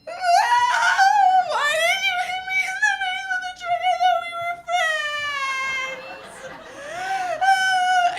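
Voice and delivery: put-on high, loud wailing child's voice; crying little kid. Hit kid wailing at his friend, high loud crying voice. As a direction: wail it like a hurt toddler. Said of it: "this other kid is like".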